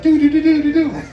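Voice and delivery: high, loud whistle sounds do do do; mock whistle tune. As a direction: imitate the bar guy's whistle, high and loud. Imitating a Tijuana bar whistle.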